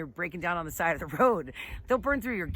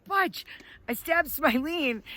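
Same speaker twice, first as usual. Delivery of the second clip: high-pitched mock yell, slowing after the name; retold scream; 8 semitones higher, slower than usual. Boss calling to Butch at ski patrol, screaming. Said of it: "he starts screaming".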